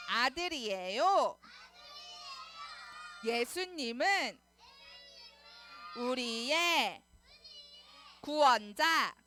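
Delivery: slow, loud phrase-by-phrase chant; loud calling out. Shown as a demonstration. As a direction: shout each phrase for children to echo. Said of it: "크게 외쳐볼까요".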